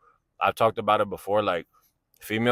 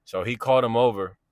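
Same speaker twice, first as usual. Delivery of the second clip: normal talking voice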